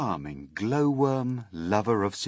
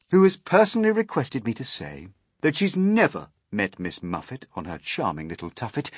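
usual speaking voice

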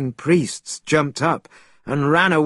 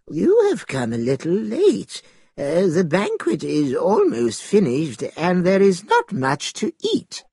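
put-on high, wavering old man's voice; 5 semitones higher than usual. A narrator's reading. Old man greeting a late guest at his door, old man's voice. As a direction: play an elderly host, pitched up. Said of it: "An old man came to the door".

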